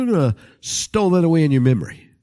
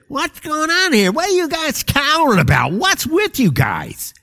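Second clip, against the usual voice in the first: mocking imitation; 13 semitones higher than usual. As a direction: mimic a naive kid in a squeaky voice. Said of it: "you come in and say".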